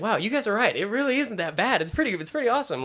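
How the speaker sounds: high-pitched, brightening wonder, retold; amazed realization. Actor comforted by mom and brother, amazed realization. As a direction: act out happy surprise, speeding up at the end. Recalls realization among family.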